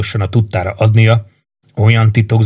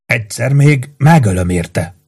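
ordinary delivery, nothing marked

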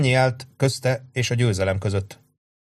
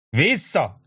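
high shouted command; officer shouting retreat; 7 semitones higher than usual. A narrator's reading. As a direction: call the retreat. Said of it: "kiáltotta Sharp".

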